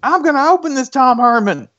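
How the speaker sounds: very high, huffy mock Texan; thick Texas accent. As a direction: keep up the hammed-up Texas squabble. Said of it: "extremely thick Texas accents".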